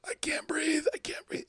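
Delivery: breathless, panicked whisper of "I can't breathe"; gasping whisper. Retold or imitated. Retelling a girl during deliverance, panicked whisper. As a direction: gasp it out with no voice, fading. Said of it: "'cause she couldn't breathe".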